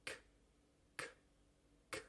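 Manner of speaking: "K" sorda casi en la garganta; k desde la garganta. Shown as a demonstration. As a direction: cierra atrás y suelta la k con aire. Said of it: "en la parte de atrás de la boca". (k sorda desde atrás de la boca)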